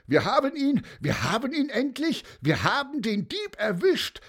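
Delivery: high, excited crowd cry; triumphant shouting. A narrator's reading. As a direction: shout out the catch, over and over. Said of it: "brüllte, ja, eben noch jemand".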